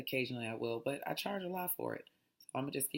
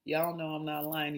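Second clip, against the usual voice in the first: usual speaking voice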